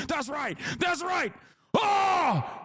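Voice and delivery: high, strained hype cries rising to a yell; mock patriotic whooping. Streamer boasting of American military might, mock-triumphant whooping.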